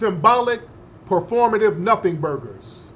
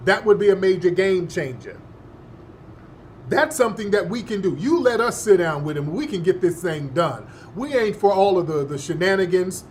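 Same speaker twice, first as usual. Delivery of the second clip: usual speaking voice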